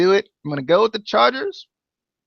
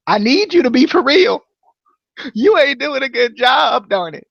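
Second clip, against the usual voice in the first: louder, higher plea to his quarterback; exasperated plea; 5 semitones higher, 4 dB louder than usual. Real, not acted. Fan pleading loudly with his fantasy quarterback. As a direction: beg the struggling player to step up.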